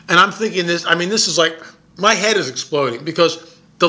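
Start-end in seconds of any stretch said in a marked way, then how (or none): none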